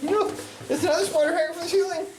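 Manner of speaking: high, loud spider shriek; screaming at a spider. Real, not acted. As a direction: squeal it out in a mock panic. Another spider on the ceiling.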